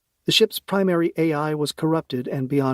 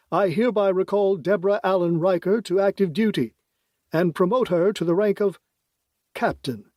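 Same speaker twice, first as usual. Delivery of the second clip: higher, ceremonious official proclamation; formal politician voice; 6 semitones higher than usual. Acted. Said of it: "speak in that formal voice".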